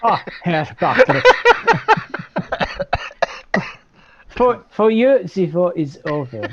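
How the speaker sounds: laughing mock German officer; comic German officer accent. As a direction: ham up a German guard, giggling through it. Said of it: "I'm not doing this in a German accent".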